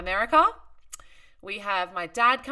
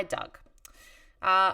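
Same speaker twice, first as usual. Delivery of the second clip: ordinary delivery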